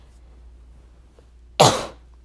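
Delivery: hard sputtering cough fit; choking on a drink. Real, not acted. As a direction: cough out water you choked on. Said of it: "刚被水呛到".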